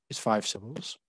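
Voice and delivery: ordinary talk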